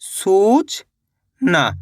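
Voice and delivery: a teacher stretching सूचना slowly for the class; drawn-out blended word. Shown as a demonstration. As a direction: stretch सूचना very slowly, each sound held. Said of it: "तो मिलके बन जाएगा अपने".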